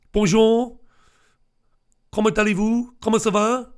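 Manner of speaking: French. Acted. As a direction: play at being French, high-pitched. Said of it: "That's very French".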